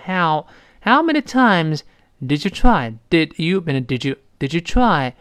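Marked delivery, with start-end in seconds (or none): 0.1-1.8 how with the mouth wide open, slow. Shown as a demonstration. Teacher demonstrating an open how slowly. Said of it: "把嘴张开".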